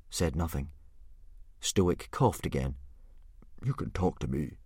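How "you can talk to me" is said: softer, lower coaxing. A narrator's reading. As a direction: gently encourage him. Stoic coaxing silent son.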